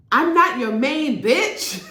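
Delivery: slow, high, loud mock yell; hammed-up slow shout; retold shout. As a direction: fling it out slowly like a public outburst. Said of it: "she shouts it at the lobby".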